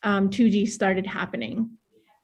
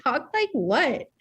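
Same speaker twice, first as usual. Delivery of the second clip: put-on drawn-out northern accent, higher voice; playful, long-vowelled northern accent; 5 semitones higher, slower than usual. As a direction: stretch it slowly in a hammed-up northern accent. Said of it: "making fun of my northern accent".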